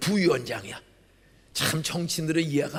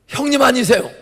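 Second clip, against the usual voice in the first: raised question; 8 semitones higher, 11 dB louder than usual. Retold or imitated. Asking the drunk caller.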